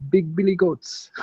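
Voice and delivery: high, slow Jamaican sign reading; "Big Billy Goats" drawn out in a mock Jamaican lilt; Jamaican accent. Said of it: "I always say it with, like, a Jamaican accent".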